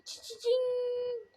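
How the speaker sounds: chewing gum while talking; words round a piece of gum. Real, not acted. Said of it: "Şimdi biraz sakız çiğniyorum".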